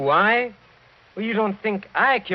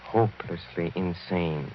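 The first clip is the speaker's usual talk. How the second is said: grim low revelation; 7 semitones lower than usual. Acted. Count's grim revelation, dropping low.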